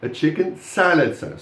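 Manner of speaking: salad punched, sandwich tucked in after it; stress on salad. Shown as a demonstration. Teacher repeats the phrase for the class, stressing salad. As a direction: make salad the peak, drop off on sandwich. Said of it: "So you stress the salad".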